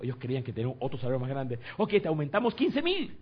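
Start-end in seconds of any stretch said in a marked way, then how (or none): 1.8-3.2 high, loud counteroffer; acted excited bargaining, pitched way up; eager employer's offer. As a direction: imitate a boss desperately upping the bid. Voices employers raising their offer.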